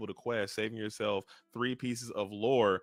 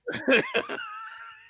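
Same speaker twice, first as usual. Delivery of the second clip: high laugh; 5 dB louder than usual. Real, not acted. Holding back thoughts, laughs.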